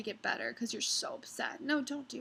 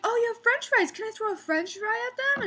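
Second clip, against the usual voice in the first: put-on high, louder, giddy drunk chirp; imitating drunk customer; 11 semitones higher, 5 dB louder than usual. Drunk woman asking a stranger for fries, high giddy chirp. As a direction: ask like a tipsy lady delighted by fries. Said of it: "She's like".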